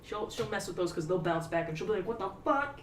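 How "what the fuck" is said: high, baffled cat reaction; mock startled. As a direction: voice a cat caught off guard.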